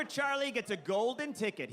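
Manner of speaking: ordinary talk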